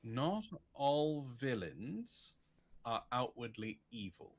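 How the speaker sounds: ordinary talk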